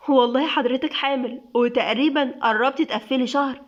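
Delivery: ordinary delivery, nothing marked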